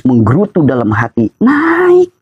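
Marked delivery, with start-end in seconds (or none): 1.4-2.1 squeaky high "naik". Acted. Pressure rising, pitch jumps up.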